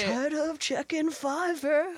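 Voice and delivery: high, slow belted parody; mock anthem line. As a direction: stretch it out like a rock chorus. Joins the mock ad song.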